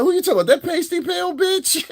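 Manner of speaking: high, loud mock outburst; imitating dumb-loud woman. Woman he was dating asks about someone, imitated dumb loud. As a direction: blurt it high, imitating her brazenness. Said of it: "she said the shit dumb loud".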